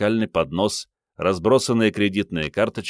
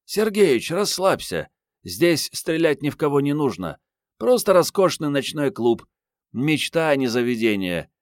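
high-pitched woman coaxing her boss to relax; Mariette, carefree; 8 semitones higher than usual. A narrator's reading. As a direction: pitch her up high, shrugging off his concern. (высокий женский голос, беззаботно уговаривает начальника)

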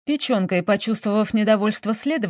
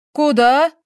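loud, raised challenge; sharp shout; 6 semitones higher, 7 dB louder than usual. A narrator's reading. Investigator stops the butler going upstairs, shouting sharply. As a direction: call him back with a sudden shout. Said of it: "прикрикнул на него следователь".

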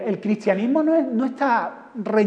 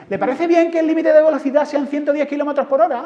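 chirpy street reporter; 6 semitones higher, 5 dB louder than usual. Retold or imitated. Street reporter asking passerby.

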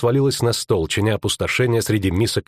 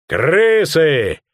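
loud, raised alarm bellow; ruffian's bellowed shout; roared; 6 semitones higher, 6 dB louder than usual. Acted. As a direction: roar the warning to the room. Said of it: "рявкнул Веркта".

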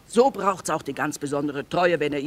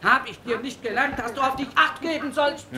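mother berating her son; angry scolding; 7 semitones higher than usual. Acted. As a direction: scold him for risking his life. Mother scolds son after slapping.

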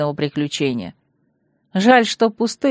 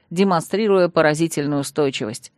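usual speaking voice

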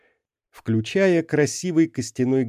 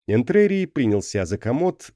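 ordinary delivery, nothing marked

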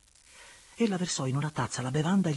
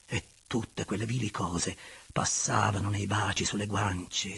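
ordinary delivery, nothing marked

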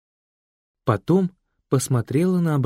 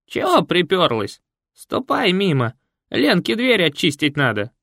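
put-on grumpy old woman; 6 semitones higher, 4 dB louder than usual. Марфа scolds her daughter and unwelcome guests, grumpy. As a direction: grumble like a sour old woman. Марфа drives Катя away.

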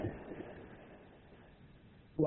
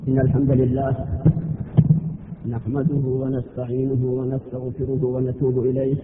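formal sermon opening; 6 semitones lower than usual. Acted. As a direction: intone the opening praise solemnly. Preacher opens the sermon formally. (افتتاح خطبة بصوت جهوري رسمي)

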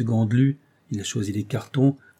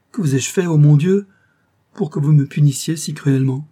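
louder, higher cry of hurt disbelief; anguished outcry; 5 semitones higher, 8 dB louder than usual. A narrator's reading. Painter begging Sabine not to leave him, anguished cry. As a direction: call out to God in pain. Lover told it is their last visit.

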